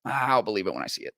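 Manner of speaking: low, quick, deflated imitation; mock resignation. Partisans as reality dawns on them, mock resigned. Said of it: "the reality dawns on them".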